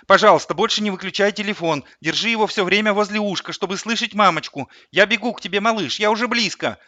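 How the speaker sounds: urgent, high-pitched mother on the phone; hurried chatter. A narrator's reading. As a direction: rattle it off, anxious to keep the child listening. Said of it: "затараторила Лариса". (торопливая тараторка)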